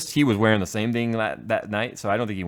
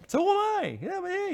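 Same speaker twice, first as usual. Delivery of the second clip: much higher, imitated objection; retold high-pitched protest. Man urging a married friend along, high-pitched mock protest. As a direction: pitch it way up, mimicking him.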